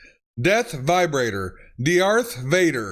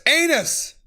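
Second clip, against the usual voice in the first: excited outburst; 9 semitones higher than usual. Real, not acted. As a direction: blurt out Anus with glee. Reading funny text aloud.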